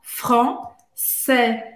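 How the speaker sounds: "français" said slowly, nasal first vowel, silent s; careful French pronunciation. Shown as a demonstration. Teacher modelling the word for students, slowly. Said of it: "Olha como é que a gente fala".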